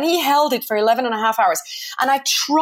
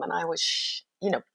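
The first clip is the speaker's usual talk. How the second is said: whispered fear; 3 semitones lower, 7 dB quieter than usual. Real, not acted. Nervous at border.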